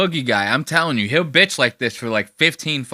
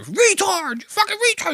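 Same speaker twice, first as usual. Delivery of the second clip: Boogie screaming. Acted. Commentator mocks Boogie yelling at Legal Eagle, mock screaming. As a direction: screech it in falsetto, imitating a meltdown. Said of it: "He's screaming".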